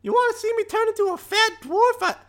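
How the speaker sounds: very high, showy squeaky brag; boastful falsetto. Acted. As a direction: show off to the kid in a squeaky voice. Shapeshifter boasting to the girl.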